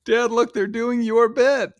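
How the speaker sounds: put-on high, slow young girl's voice; high drawn-out daughter voice pointing at the TV; imitating the daughter. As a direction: tease dad like a grown-up kid. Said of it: "she's gonna be like".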